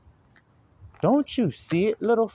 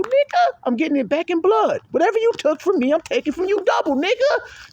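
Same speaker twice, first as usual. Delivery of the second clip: fiery zeal; 14 semitones higher than usual. Shown as a demonstration. Preacher showing the flock what zeal sounds like, fierce high shout. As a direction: shout it like you're taking it back in blood. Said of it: "That's zeal".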